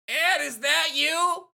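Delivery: high, slow calling out to Ed; Nadine calling out. Acted. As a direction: call through the house, high and drawn out. Acting Nadine calling to husband.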